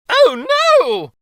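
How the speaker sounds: high, loud frightened wail. A narrator's reading. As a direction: wail in panic at the saw. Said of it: "wailed King Kenric".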